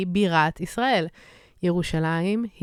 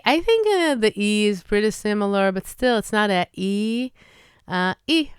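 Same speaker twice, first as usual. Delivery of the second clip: ordinary talk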